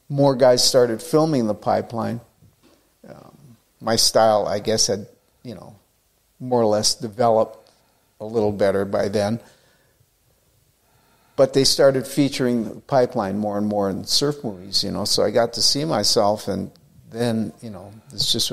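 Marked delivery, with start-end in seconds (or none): none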